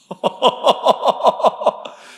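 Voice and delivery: a surprised laugh climbing up; laughter at his own story; high rising laugh. Real, not acted. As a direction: laugh in amused disbelief. Laughs at God's answer.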